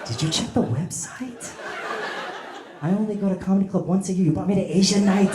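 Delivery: mock-offended wife scolding her husband; indignant wife who only goes once a year; annoyed tourist.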